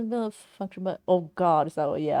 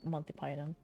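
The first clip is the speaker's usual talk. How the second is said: ordinary talk, nothing marked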